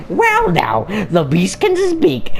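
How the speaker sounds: high, haughty queen voice; high villain voice. Acted. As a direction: sneer like a spider queen.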